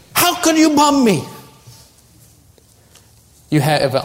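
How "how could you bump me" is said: high, indignant mock outburst; angry outburst. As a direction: explode in offence, voice leaping up. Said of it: "You have outbursts of anger".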